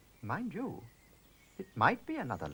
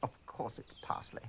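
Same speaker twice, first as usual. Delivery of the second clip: hushed, unvoiced aside; whispered aside; 3 semitones lower, 8 dB quieter than usual. Rosemary whispers to Sir Basil, hushed and secret.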